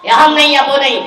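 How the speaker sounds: loud, high, slowed verdict; loud, stubborn daughter; angry ultimatum. Retold or imitated. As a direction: lay down the either-or ultimatum. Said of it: "बोले".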